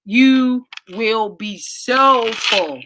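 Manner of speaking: slow, stretched emphatic promise; drawn-out full. Real, not acted. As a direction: stretch every word out slowly.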